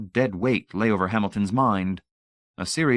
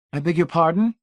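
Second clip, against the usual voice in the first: feigned innocence, high and bright; innocent; 10 semitones higher, 4 dB louder than usual. Acted. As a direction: play dumb sweetly. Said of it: "Brady said innocently".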